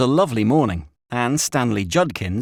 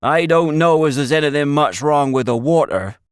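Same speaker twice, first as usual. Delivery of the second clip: loud, higher rustic voice; old country shepherd; 6 semitones higher, 6 dB louder than usual. A narrator's reading. Shepherd telling the boys nobody uses the well, emphatic rustic. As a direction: tell them firmly in country speech.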